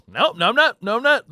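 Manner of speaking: high, loud insistent denying; loud denial. Retold or imitated. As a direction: deny it hard to the parents. Denying lie to parents.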